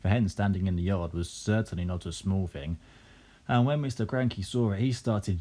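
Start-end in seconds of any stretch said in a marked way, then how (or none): none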